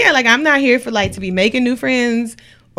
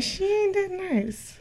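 slow, softer knowing verdict; mock verdict; 9 semitones higher, 11 dB quieter, much slower than usual. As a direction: drag out the gossip slowly. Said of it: "it's like".

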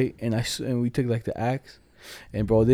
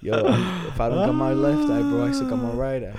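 mock high squeak; 12 semitones higher than usual. Replays his own boast, mock squeaky.

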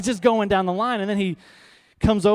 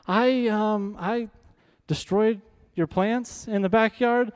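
high, halting, imitated sheepish boy; nervous confession; 4 semitones higher, slower than usual. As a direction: act out a scared kid confessing, slowly and haltingly. Sheepish boy confessing to officer neighbor.

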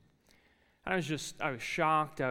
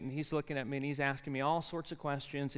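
ordinary talk, nothing marked

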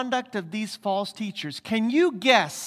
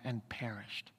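hushed whisper, much softer; 10 semitones lower, 15 dB quieter than usual. Real, not acted. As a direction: breathe the word out as a final hush. Dramatic end of flood verse.

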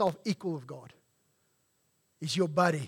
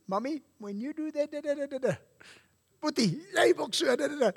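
higher little-kid voice, playfully put on; imitating a small child; 7 semitones higher than usual. Dad voicing his seven-year-old complaining to Mommy and Boetie, higher imitated kid voice.

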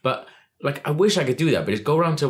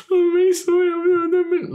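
put-on whiny kid voice; 5 dB louder than usual. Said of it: "a sixteen-year-old spotty little kid going like".

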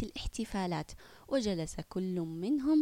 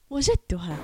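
loud, lower shout of discovery; eureka; 3 semitones lower, 6 dB louder than usual. Acted. Boy telling his mother his idea, excited.

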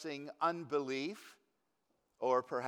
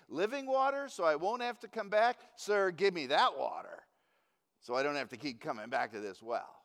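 sarcastic scoff; 3 dB louder, faster than usual. Acted. Hot, tired woman at the well, scoffing at Jesus. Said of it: "It's a bit of a scoff".

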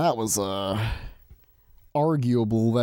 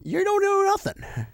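sing-song teasing, pitched way up; mock taunt. Teasing his co-host in a high playground taunt. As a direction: needle her in a high playground voice.

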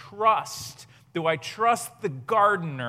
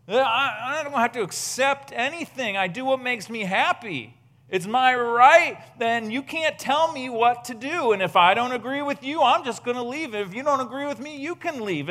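higher, defiant mock of self-centered culture; mock entitled voice; 6 semitones higher, 6 dB louder than usual. Preacher mocking the attitude of Western culture, higher and defiant. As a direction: play the spoiled "it's my right" attitude.

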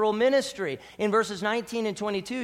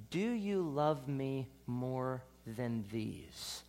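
soft, very slow, intimate question; hushed, lingering question to Peter; searching question; 6 semitones lower, 10 dB quieter, much slower than usual. Acted. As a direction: ask it quietly and slowly, searching his heart. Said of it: "Jesus singled out Peter".

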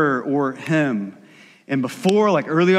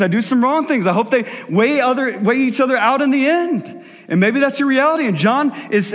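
higher, louder, urgent sermon push; fervent preaching; 6 semitones higher, 3 dB louder than usual. Acted. Pastor urging the congregation to examine their faith. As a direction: preach it out to the whole room. Preaching builds to full force.

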